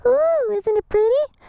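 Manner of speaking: cooing. Pretend. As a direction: coo over the new camera. Shows off new camera.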